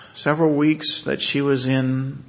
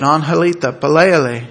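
louder, higher call of her name and the Spanish word; firm command; 4 semitones higher, 10 dB louder than usual. Retold or imitated. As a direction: call it out so she hears. Narrator commands patient in Spanish.

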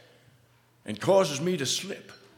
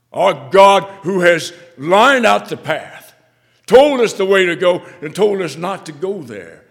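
fervent preaching; 6 semitones higher, 16 dB louder than usual. Acted. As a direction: proclaim God's path with fire. Preaching at full force.

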